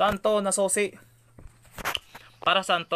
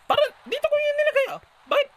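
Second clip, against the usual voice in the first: much higher, fast panicked squeak; panicked squeal; 4 dB louder, faster than usual. Real, not acted. Baby missing from where placed.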